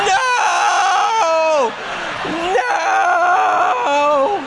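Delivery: loud, very high mock scream of no; terrified yelling. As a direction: shriek it like a horrified kid. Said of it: "she's yelling".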